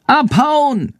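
very high screechy bat cry; Schandor screeching. A narrator's reading. Schandor warns Jendrik about the farmer, screeching. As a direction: screech the order in a piping voice. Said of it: "Schandors kreischende Stimme".

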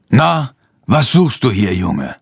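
strict farmer's questioning; stern. A narrator's reading. Farmer catches a boy in his hall, stern. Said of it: "fragt er streng".

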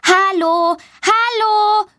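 put-on high child's call; girl calling to children in the hall; calling out loud. A narrator's reading. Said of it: "ruft sie laut".

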